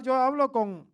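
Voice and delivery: usual speaking voice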